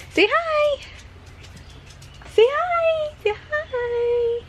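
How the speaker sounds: high sing-song "say hi". Real, not acted. Mother repeatedly asking her baby to say hi, high sing-song.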